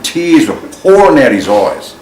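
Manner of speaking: usual speaking voice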